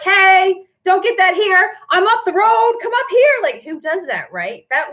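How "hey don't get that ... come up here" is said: high, loud heckler calling out across the shop; mock yelling. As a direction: shout it like a rival poaching customers. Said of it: "they're yelling out stuff like".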